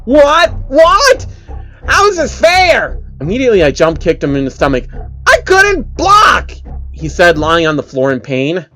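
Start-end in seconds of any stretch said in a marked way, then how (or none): 0.0-3.0 high, louder incredulous whine; whiny man-child. Acted. Phil's chainsaw runs out. 5.3-6.5 high, louder incredulous whine; whiny man-child. Acted. Phil whines after being kicked.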